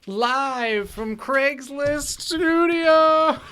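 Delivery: high, drawn-out show-opening call; hammed-up live-show announcer. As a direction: belt out the show intro, stretching every word.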